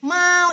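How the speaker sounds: "Mau" high, long and level; first tone. Shown as a demonstration. Teacher drilling Dina on tone one, high level "Mau". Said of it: "Mau nada satu".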